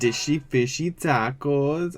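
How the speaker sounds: slow, mimicking Welsh lilt; drawn-out bad Welsh impression; attempted Welsh accent. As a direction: have a rough go at a Welsh accent. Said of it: "I can't do Welsh".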